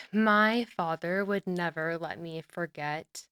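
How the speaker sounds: ordinary talk, nothing marked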